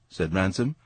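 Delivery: plain reading voice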